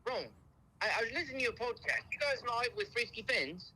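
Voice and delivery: high, hurried fake British accent. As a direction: pester them in a put-on British accent. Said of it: "the best British accent I've ever heard".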